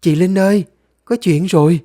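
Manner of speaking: urgent high, loud call; young girl calling with news; Liên. Acted. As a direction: call out for help from outside. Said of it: "có tiếng gọi của bé Liên".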